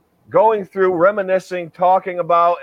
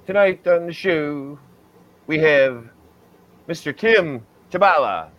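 lightly voiced Ed Sullivan; hint of old TV host; Ed Sullivan impression; 2 semitones higher than usual. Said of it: "I'm doing my best Ed Sullivan imitation".

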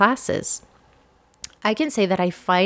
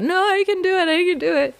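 much higher, teary protest; tearful pleading; 11 semitones higher, faster than usual. Retold or imitated. Pleading with her husband to keep watching, tearful. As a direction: beg through tears to keep the movie on. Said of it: "I was crying so, so much that I couldn't stop".

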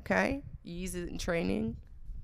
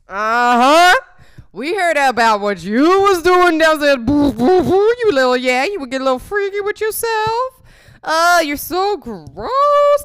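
exaggerated Wayne's accent; 10 semitones higher, 14 dB louder than usual. Parents confronting her upstairs, hammed-up Wayne's accent. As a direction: scold teasingly in a put-on Wayne's accent. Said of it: "I don't know why I gave it that Wayne's accent".

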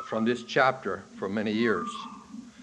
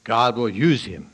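full-force preaching; 2 semitones higher, 8 dB louder than usual. Real, not acted. Preacher explaining Romans nine to the church, booming. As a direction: thunder the warning from the pulpit.

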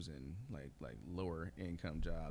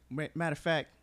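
indecisive customer; 8 semitones higher, 9 dB louder than usual. Retold or imitated. Acts out indecisive drive-through customer.

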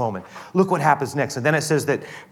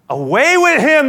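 high, loud outcry; crowd yell; 14 semitones higher, 10 dB louder than usual. Acted. The crowd rejecting Jesus before Pilate, loud yell. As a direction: yell it like an angry mob. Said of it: "they yelled".

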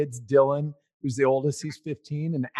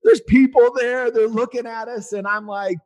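usual speaking voice

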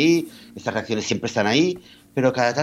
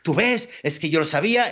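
much higher, self-satisfied gloat; smug I-knew-it; 6 semitones higher than usual. Retold or imitated. Friends gloating over the news, high smug crowing. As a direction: crow that you knew all along.

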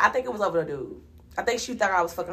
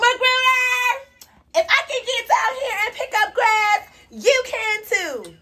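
high, loud mock wail; hammed-up complaining girl, pitched way up; whiny mimicry; 13 semitones higher, 3 dB louder than usual. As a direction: imitate her in an exaggerated high-pitched cry.

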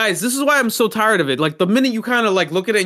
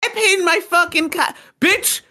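high-pitched indignant boomer imitation; mock boomer; 9 semitones higher than usual. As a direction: mimic a complaining boomer, pitched way up. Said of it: "all these fucking boomers are like".

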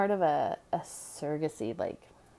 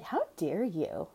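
drawn-out, slow how dare you; slow disbelieving reproach; slow indignant; much slower than usual. Retold or imitated. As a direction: let the outrage sink in slowly. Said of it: "I'm like".

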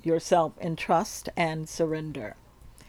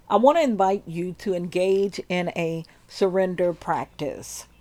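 ordinary delivery

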